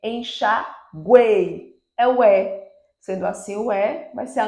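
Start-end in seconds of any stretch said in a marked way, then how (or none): 0.0-1.8 "Enxaguei" very slow, loud peak on the e of guei; strongest-vowel demo. Shown as a demonstration. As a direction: stretch "Enxaguei" syllable by syllable, swelling on GUEI. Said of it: "quem é o mais forte?".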